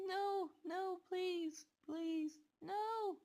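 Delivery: screaming pleas. Retold or imitated. Girl being taken away by cops, screaming and begging. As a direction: cry and plead not to be taken. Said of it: "as I screamed and cried and plead".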